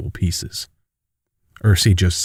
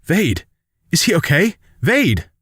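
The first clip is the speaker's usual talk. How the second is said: high-pitched acted yell without real volume; 10 semitones higher than usual. Daughter spots who holds her father, contained high yell. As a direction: call out the name high and urgently. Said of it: "she yells".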